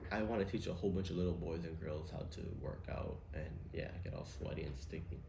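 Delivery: hint of a creepy gym coach, almost whispered; creepy gym teacher. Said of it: "this is what they're thinking".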